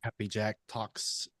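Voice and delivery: ordinary delivery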